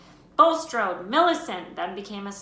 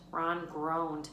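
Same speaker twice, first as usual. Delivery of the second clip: ordinary delivery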